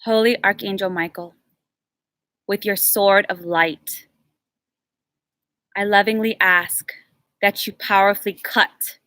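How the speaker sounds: slow, solemn spoken prayer. Acted. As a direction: pray it word by word, slowly. Leads a cord cutting prayer.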